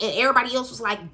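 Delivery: ordinary talk, nothing marked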